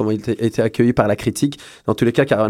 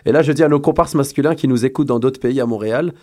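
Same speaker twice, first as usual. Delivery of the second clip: ordinary delivery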